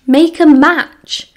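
can't believe his ears; flabbergasted. A narrator's reading. James reacts to the arranged marriage, flabbergasted. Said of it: "James sounded flabbergasted".

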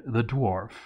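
ordinary talk, nothing marked

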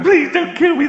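high, loud mock begging; mock pleading. Man boiled by cannibals, begging in a high mock squeal. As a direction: squeal for mercy from the pot. Boiling victim begs.

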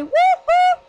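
high, loud joyful whoop; happy cheer. Real, not acted. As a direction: whoop it out with glee. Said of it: "You're, like, so happy".

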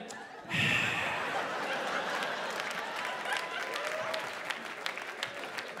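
a high, breathy imitated machine sigh; exaggerated airy sigh from the sat nav; long mock sigh. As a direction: sigh like a weary GPS. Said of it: "it almost sighs".

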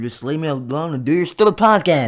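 fed-up twin yelling at his brother; angry outburst. Acted. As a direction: shout him down in a rage. Twin argument escalates.

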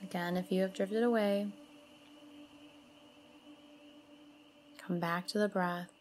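soothing mantra-and-breath guidance, spaciously phrased; guided meditation. Acted. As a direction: lead them gently through the breathing, unhurried.

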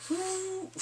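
high, soft swooshing noise; imitated sword whoosh. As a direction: mimic a blade cutting through air.

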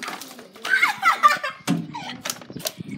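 very high laughing shriek. Real, not acted. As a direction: squeal with laughter.

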